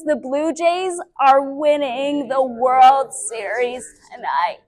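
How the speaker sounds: slow sentence spoken on a full exhale; on the breath out. Shown as a demonstration. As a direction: let the words ride out on the breath. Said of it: "I'm gonna say the sentence".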